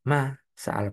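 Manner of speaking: MA held, then SA'ALA as its own word; split into two words. Shown as a demonstration. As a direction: say "ma sa'ala" with a long MA and a break before sa'ala. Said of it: "bukan masalah".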